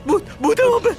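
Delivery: very high, gasping alarm; high-pitched frantic warning; panicked cry. Acted. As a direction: cry out in terror that something is there. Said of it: "तुम बेकार का चिल्लाना बंद करो".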